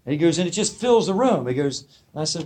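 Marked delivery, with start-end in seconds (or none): 0.4-1.5 lightly voiced old hippie, higher pitch; a touch of hippie amazement; Mickey. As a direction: imitate a marvelling hippie, pitched up. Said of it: "this is the way he talks".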